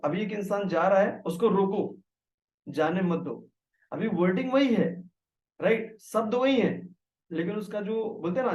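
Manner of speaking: ordinary delivery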